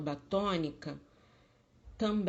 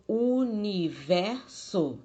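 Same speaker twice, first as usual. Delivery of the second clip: universo slowly broken into u-ni-ver-so, louder; syllable by syllable; 2 semitones higher, 5 dB louder, slower than usual. Shown as a demonstration. Teacher showing a four-syllable word, universo slowly syllable by syllable. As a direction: chop universo into four slow, strong beats.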